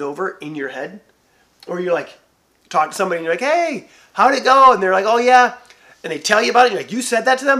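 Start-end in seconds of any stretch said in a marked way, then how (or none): 3.4-4.7 high, loud and slow eager ask; eager question. Retold or imitated. Friend asking how the talk went, eager and loud. 5.1-5.5 high, loud breezy answer; casual reply. Retold or imitated. The friend brushing it off, loud and high. 6.9-7.6 higher, louder disbelieving ask; can't believe they said it; shocked question. Retold or imitated. Listener shocked at what the friend said, loud.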